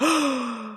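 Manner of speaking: big mock-scandalized gasp; offended gasp. Reacting to the accusation, exaggerated offended gasp. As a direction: suck in air as if affronted. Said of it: "really offended".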